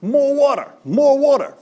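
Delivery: high, loud call across the garden; yelling to Jack. Retold or imitated. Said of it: "So I yell to Jack".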